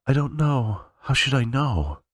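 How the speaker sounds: high, slow, tear-choked despair; high and strained with crying. Acted. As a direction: weep it into a pillow. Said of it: "Linda was crying too".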